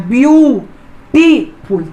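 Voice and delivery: Beautiful split clearly, view … टी … फुल; three separate beats for beautiful, pausing after view and टी; syllable by syllable. Shown as a demonstration. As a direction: sound out beautiful one syllable at a time. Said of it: "तीन हिस्सा में बंट रहा है".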